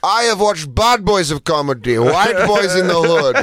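emphatic mock Indian cabbie; hammed-up Indian accent. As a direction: play an Indian comedy buff listing favorites. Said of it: "with an accent like that".

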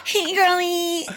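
put-on high, bubbly hey girly through laughter; gushing girly greeting. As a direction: try on the voice she's teased for. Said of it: "Do I do that?".

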